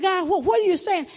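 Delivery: normal talking voice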